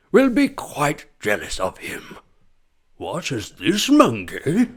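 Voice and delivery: raspier Attenborough. Retold or imitated. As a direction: add rasp to the documentary voice. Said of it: "there's a bit more rasp in it".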